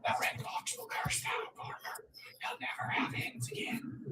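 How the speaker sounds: grandpa's whispered warning. Retold or imitated. Grandson passes on grandpa's fox warning, whispered. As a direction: whisper it low like a curse. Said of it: "My grandpa always told me".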